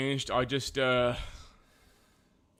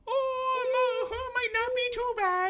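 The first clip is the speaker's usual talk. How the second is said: mock crying. Mock sobbing repeat.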